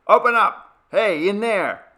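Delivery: loud high yell of a man banging on the door; shouting through the door. Acted. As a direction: bellow to be let in.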